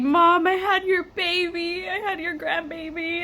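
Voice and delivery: slow, high, almost whimpering re-enactment; retold emotional announcement. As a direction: break the news to mom, high and drawn out. Tearful phone news retold.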